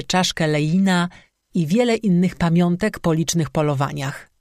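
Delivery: ordinary delivery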